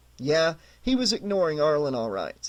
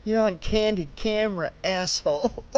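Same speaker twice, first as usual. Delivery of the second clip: higher grinning taunt; stupid grin; 4 semitones higher than usual. A narrator's reading. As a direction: say it smiling like a fool. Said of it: "Arlen said with a stupid grin".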